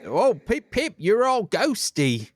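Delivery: high, hammed-up English accent; mock English accent. Host gushes at Maureen in a high mock English accent. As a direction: play a silly Englishman. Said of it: "accent you're doing is pretty offensive".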